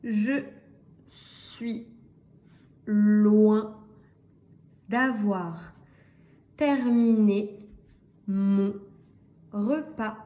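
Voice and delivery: very slow, one word at a time; long pauses between words, key words drawn out; slow reading model. Shown as a demonstration. Teacher reading the last sentence with learners, slow and deliberate.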